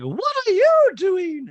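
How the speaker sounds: high-pitched, loud, drawn-out impression; Dorit. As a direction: belt it out slow and very high. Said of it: "does her serious Dorit voice".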